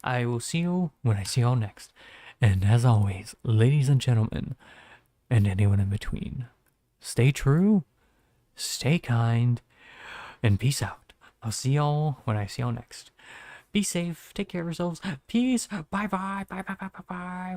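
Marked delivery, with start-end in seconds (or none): 2.4-11.1 louder projected catchphrase outro; announcer sign-off. Acted. 13.7-17.5 high cutesy goodbye. Acted. Playful goodbyes to chat.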